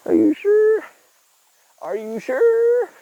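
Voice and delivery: high, loud mocking squeal of doubt; teasing falsetto. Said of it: "I'm like".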